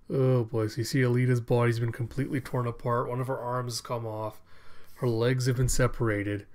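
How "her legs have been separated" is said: ordinary talk, nothing marked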